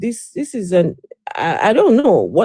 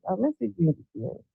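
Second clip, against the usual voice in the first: muffled; 9 dB quieter, much faster than usual. Real, not acted. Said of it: "you sound very muffled".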